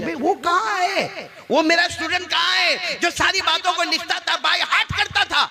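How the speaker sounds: high, urgent call for a missing student; worried teacher. Acted. Teacher asking class where his best student is, urgent. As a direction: cry out the question at a high pitch.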